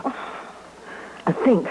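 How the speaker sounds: a soft unvoiced "now think"; hushed, intent whisper; whispered urging. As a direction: breathe it out, pressing her to remember. Urging the girl to remember.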